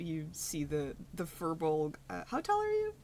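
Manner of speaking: ordinary talk, nothing marked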